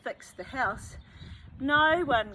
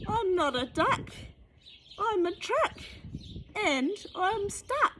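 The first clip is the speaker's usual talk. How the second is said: high, louder panicky truck voice; frightened little truck; 10 semitones higher, 4 dB louder than usual. Acted. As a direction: plead in a high small-truck voice.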